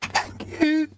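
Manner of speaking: man doing a high woman's voice; lady voice. Acted. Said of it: "that was my lady's voice".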